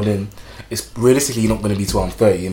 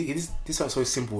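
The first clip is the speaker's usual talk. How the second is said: ordinary talk, nothing marked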